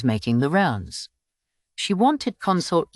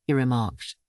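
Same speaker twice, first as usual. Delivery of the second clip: ordinary delivery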